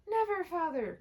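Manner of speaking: put-on children crying out to father; children's cry. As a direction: cry out together. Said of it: "cried they all".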